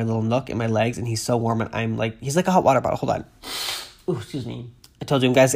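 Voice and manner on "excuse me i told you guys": ordinary talk, nothing marked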